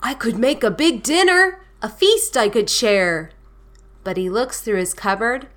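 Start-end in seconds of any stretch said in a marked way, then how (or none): 0.0-3.3 higher, eager storybook bear; lightly acted bear with a plan; Bear's eager idea. A narrator's reading. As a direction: voice the bear having a bright idea. Voices Bear's hopeful idea.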